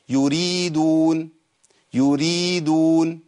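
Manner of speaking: يريدون with the ii drawn out; long ya madd. Shown as a demonstration. Teacher demonstrating ya vowel length. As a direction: stretch the ii in يريدون slowly. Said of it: "مثال الياء".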